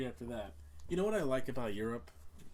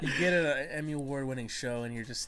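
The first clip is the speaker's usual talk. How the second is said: ordinary talk, nothing marked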